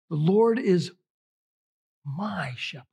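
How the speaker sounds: MY set apart after a long pause; pause, then lean on "my"; stress on my. Shown as a demonstration. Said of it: "I love this one".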